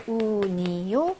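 slow, spaced-out syllables; teacher's drawn-out example; slow modelling. Shown as a demonstration. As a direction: stretch each syllable for learners.

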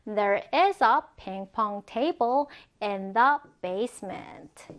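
very slow careful sentence; slow example reading. Shown as a demonstration. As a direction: read slowly for learners. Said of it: "예문을 보면". (천천히 예문 읽기)

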